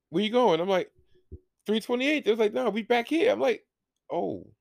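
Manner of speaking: high squeaky quoted back-and-forth; high-pitched voices. Retold or imitated. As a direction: squeak out the dialogue. Acted quick exchange about seats.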